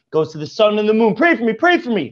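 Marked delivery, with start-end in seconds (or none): 1.2-2.1 higher-pitched imploring repeat; a hint of frantic begging, raised pitch; desperate plea. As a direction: plead over and over, frantic. Begging the sun and moon.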